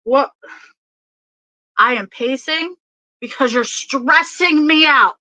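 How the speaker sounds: acted stressed-out outburst, rising in pitch; channelled exasperation. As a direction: build to an exasperated mock yell. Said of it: "this person is like".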